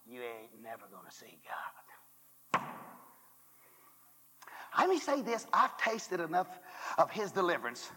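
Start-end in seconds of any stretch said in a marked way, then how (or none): 0.1-2.6 slow whisper. Acted. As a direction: whisper it slowly, like a secret warning. Hushed punchline to the congregation.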